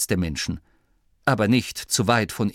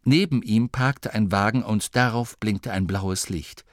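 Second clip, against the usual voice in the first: ordinary delivery, nothing marked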